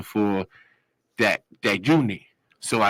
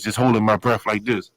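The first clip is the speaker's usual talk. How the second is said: normal talking voice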